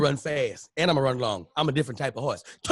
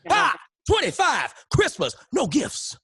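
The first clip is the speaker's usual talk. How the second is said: much higher, louder gloating "No gifts"; triumphant taunt; 10 semitones higher, 5 dB louder, slower than usual. Retold or imitated. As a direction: rub it in hard. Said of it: "gloating at daddy".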